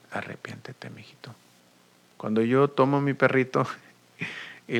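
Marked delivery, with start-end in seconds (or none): 0.1-1.3 whispered rebuke. Acted. God gently correcting the believer.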